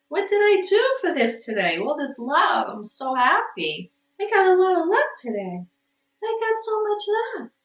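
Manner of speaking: high, delighted cooing. Real, not acted. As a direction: gush happily over an affectionate cat. Gushing over the cat's affection.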